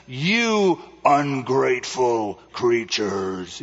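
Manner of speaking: slow, low, drawn-out wizard; the great Oz thundering, slowly; booming wizard voice. Retold or imitated. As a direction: play the fake wizard scolding. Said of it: "in that voice".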